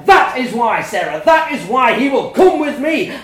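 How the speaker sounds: very loud, high shouting; furious shout. A narrator's reading. Parents shouting at each other over the child. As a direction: blast it across the table.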